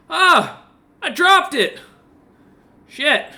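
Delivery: loud, high cry of dismay; Aussie calling from above. Acted. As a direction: holler down in dismay, Australian style. Aussie above drops the barrel.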